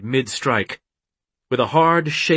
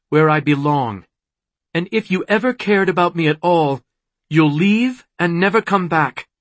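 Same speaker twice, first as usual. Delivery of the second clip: higher, emphatic, impassioned voice; firm plea; 5 semitones higher, 3 dB louder than usual. A narrator's reading. Daughter telling villain to leave forever, impassioned. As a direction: raise the pitch and send him away.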